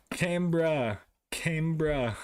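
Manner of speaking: Canberra carefully as CAM-brah, two syllables; practised pronunciation. Shown as a demonstration.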